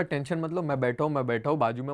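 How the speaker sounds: calm her down gently; reassuring. Retold or imitated. Calming scared wife on ride. (डरी पत्नी को धीरे से तसल्ली देना)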